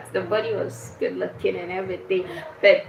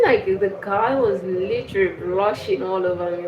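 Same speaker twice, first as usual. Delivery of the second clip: ordinary talk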